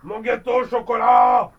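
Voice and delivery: loud, high anguished cry; shout. Acted. Pastry chef discovers his cake is gone, screaming. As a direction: scream out over the lost cake. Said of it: "qu'il entendit un cri".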